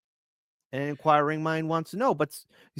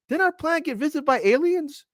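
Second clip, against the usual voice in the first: high mock airhead voice; 12 semitones higher than usual. Mocking forgetful people a week later.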